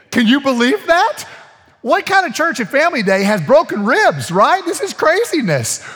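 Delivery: high, loud disbelieving exclamation; incredulous outcry. Real, not acted. To the church about a broken rib at family day, loud incredulous. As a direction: pitch it up and out in amazed disbelief.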